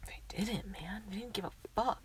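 whispering. Real, not acted. Whispered complaint.

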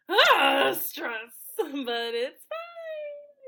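high, drawn-out fake whimper; comic high squeal of stress; mock distress. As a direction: stretch it out as a pretend wail through laughter. Mock distress over unbooked trip.

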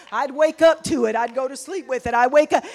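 loud preaching. Acted. Preaching at full force.

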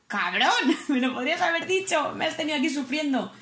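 laughing scold. Retold or imitated. Scolding instructor while laughing.

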